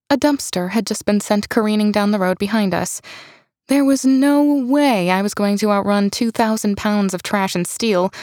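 usual speaking voice

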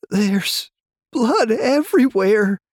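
high, loud panicked cry. A narrator's reading. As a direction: cry it out in horror. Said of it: "the man cried".

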